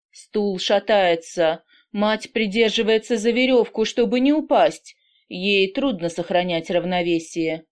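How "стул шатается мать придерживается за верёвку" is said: ordinary delivery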